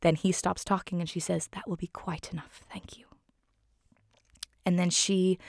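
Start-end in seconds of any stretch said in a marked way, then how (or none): 1.5-3.0 very soft, prim dismissal; near-whispered polite put-down; hushed reprimand. Acted. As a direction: quietly shut down an argument. Mary Poppins silences the bickering parrot.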